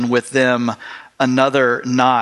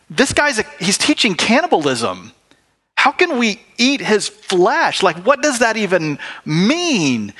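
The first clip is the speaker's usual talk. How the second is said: much higher, shocked disbelief; scandalized crowd; 8 semitones higher than usual. Retold or imitated. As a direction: recoil at the thought of cannibalism. Crowd shocked at eating flesh.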